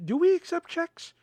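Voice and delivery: high-pitched mock-clueless question; playing dumb; asinine question. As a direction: ask it as if truly stumped, voice way up. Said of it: "the most asinine fucking questions".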